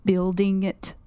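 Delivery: BUILDING IT with each syllable enunciated; Billy. Retold or imitated. Co-host quoting how Billy says it, BUILDING IT over-enunciated. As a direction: spell out BUILDING IT syllable by syllable, deliberately. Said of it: "enunciates each syllable".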